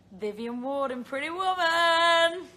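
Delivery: loud, high, drawn-out announcement; excited announcement. Real, not acted. Performer revealing her Broadway dream role to fans, excited. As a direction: belt out the title slowly and proudly.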